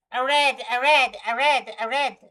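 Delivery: loud, insistent chant-like repeats; repeated calling out. Pretend. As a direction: belt it out over and over. Playfully belting out a repeated word.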